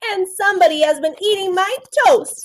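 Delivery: put-on a small kid telling on someone; little person voice. A narrator's reading. Teacher voicing the little person for the class, mildly upset. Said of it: "said the little person".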